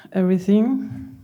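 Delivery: ordinary talk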